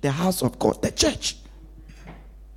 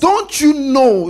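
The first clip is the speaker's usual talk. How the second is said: high, loud and slow rebuke; a young Jesus protesting his parents; drawn-out indignant question; 9 semitones higher, 6 dB louder, slower than usual. Acted. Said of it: "quoting Jesus".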